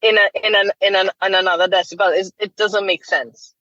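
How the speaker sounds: ordinary delivery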